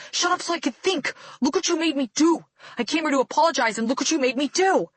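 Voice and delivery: high, loud furious yelling; raging shout. A narrator's reading. Keith blaming Kayla for what he did, raging loudly. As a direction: blame her at full volume.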